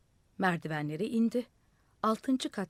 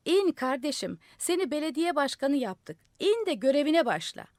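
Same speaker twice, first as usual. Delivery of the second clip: raised, higher calling voice; 7 semitones higher, 5 dB louder than usual. A narrator's reading. Fire chief calling up to the madman on the roof, loud call. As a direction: call up through cupped hands. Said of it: "ağzına boru yapıp yukarı seslendi".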